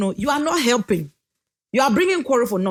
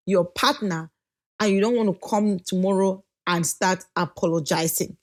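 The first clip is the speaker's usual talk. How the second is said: ordinary talk, nothing marked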